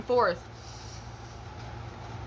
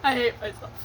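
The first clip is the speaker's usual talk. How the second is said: ordinary talk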